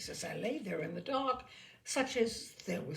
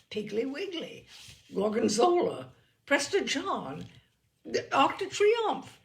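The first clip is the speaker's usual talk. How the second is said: building higher and louder each word; mounting panic; 5 semitones higher, 9 dB louder than usual. A narrator's reading. Narrator losing his grip on words, rising panic. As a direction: rattle the words off as panic climbs.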